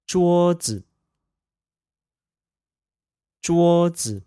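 桌子 said zhuō zi, high level then light neutral; 桌子 with a first tone and an unstressed zi; practicing tones. Shown as a demonstration. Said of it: "neutral tone pair".

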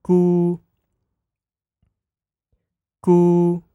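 gu held high and level; first tone. Shown as a demonstration. Teacher models gu for listeners, high level tone. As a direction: say gu on a high, flat tone. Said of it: "let's sing the four tones".

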